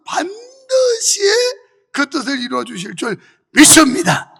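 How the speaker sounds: loud, forceful sermon delivery; preaching. Real, not acted. Pastor urging congregation to endure through faith, forceful preaching. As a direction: preach it with driving conviction.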